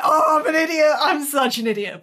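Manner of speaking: mock wail, settling down; mortified self-reproach. Fan walks away from idol, mock mortified. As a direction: kick yourself, half in jest.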